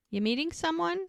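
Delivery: pitched well up, lightly voiced question; high quoted question. Brady asking Bree at the bar, high curious question. She asks him.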